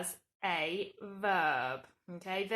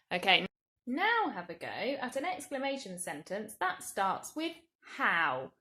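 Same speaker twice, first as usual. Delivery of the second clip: ordinary delivery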